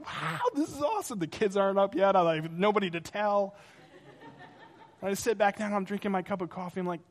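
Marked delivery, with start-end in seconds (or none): 0.0-1.2 excited. Retold or imitated. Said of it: "And I'm like".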